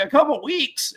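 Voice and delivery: high, disbelieving echo; mock shock at the wait; incredulous. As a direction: repeat it like you can't believe it. Incredulous retold reaction.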